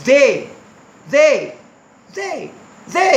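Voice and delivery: "जे" with a voiced th, American accent; separate, drilled American-accent "जे". Shown as a demonstration. Teacher drills the word for learners, American-accent "जे". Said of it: "American accent".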